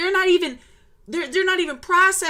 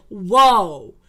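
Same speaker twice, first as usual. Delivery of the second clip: stunned whoa; 3 semitones lower, 9 dB louder than usual. Retold or imitated. Shocked reaction.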